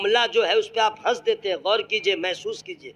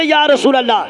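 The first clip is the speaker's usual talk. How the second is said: loud crowd call; 5 semitones higher, 11 dB louder than usual. Real, not acted. Preacher leading crowd.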